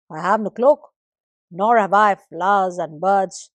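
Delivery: loud, jealous grievance; sky complains to his son, loud and jealous; complaining. Acted. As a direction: grumble loudly about the earth's finery. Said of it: "the sky began to complain".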